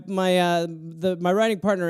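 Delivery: ordinary talk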